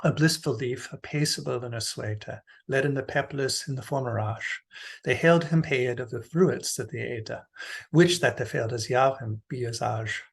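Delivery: reading verse in Middle English, every letter pronounced; Middle English recitation. Shown as a demonstration. Lecturer shows the class how it goes, Middle English recitation. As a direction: recite it with continental European vowels. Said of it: "here's how it goes in Middle English".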